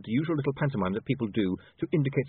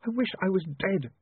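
lightly acted sob, higher voice; a touch of tearful misery, pitched up; sobbed; 10 semitones higher than usual. A narrator's reading. Woman crying through her laughter, sobbing lightly. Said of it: "she sobbed".

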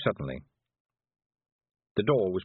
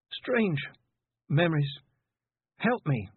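high, halting, disoriented voice; dazed plea; 7 semitones higher, slower than usual. A narrator's reading. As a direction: grope for each word, then beg for help. Dazed girl pleads for help.

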